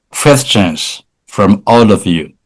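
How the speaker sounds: normal talking voice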